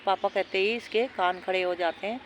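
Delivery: ordinary talk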